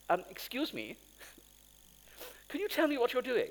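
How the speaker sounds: mock posh English accent, politely asking; posh friend. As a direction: put on a plummy posh English voice, lifting up. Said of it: "very posh".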